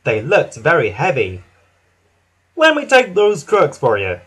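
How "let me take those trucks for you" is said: higher, louder eager offer; eager to lend a hand; helpful Hank. A narrator's reading. Hank offering Thomas help, eager and higher. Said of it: "chuffed Hank helpfully".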